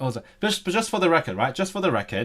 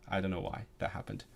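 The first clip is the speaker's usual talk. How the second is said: usual speaking voice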